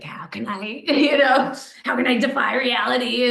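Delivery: hammed-up grand aspiration; creators' yearning. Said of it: "We're like".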